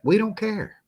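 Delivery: high-pitched, insistent brush-off; emphatic dismissal. Real, not acted. Repeats dismissal two ways.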